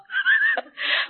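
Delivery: a sudden high laugh at herself; rueful laugh. Real, not acted. A woman laughing at her own prayer while telling the church, rueful laugh. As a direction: laugh off the memory of her plea.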